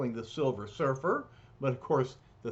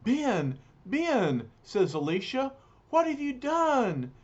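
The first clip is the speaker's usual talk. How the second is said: alarmed cry; 10 semitones higher, 3 dB louder than usual. Acted. Alicia cries out in shock.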